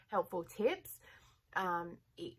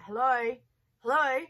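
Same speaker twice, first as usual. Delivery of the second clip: much louder "Hello, hello" called out at the door; mock loud call; 6 semitones higher, 10 dB louder than usual. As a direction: yell "Hello" like she's right outside. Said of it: "just yelling".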